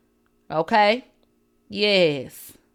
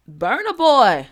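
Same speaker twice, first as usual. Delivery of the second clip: ordinary talk, nothing marked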